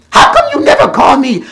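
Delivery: high, loud complaining mother voice; entitled mom. Retold or imitated. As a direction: whine it up high like a demanding mother. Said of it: "she would always say".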